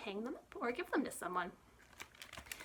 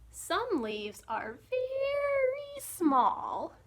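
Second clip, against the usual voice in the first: tiny voice; 9 semitones higher, 5 dB louder, much slower than usual. Acted. Tiny voice for small leaves.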